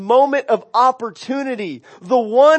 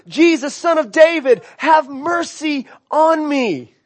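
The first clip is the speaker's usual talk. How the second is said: crying out louder; 6 semitones higher than usual. Retold or imitated. Blind beggar crying louder to passing Jesus, raised plea. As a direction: call it out like a desperate beggar. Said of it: "He starts crying out louder and louder".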